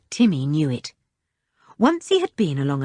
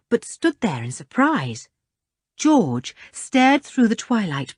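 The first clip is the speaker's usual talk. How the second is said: plain reading voice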